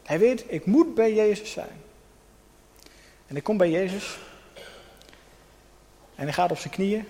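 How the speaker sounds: usual speaking voice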